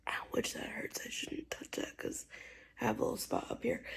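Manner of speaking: sudden real whisper after touching a sore spot; a real hushed ow as her head hurts; pained whisper aside. Real, not acted. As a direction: drop to a hushed aside, wincing.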